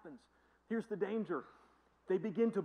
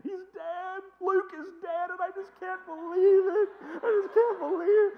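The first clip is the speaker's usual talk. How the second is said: high, loud wailing mock sob; crying out in over-the-top grief; hammed-up sobbing; 14 semitones higher, 12 dB louder than usual. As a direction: wail over a soap star's death. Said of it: "Bertha started pointing".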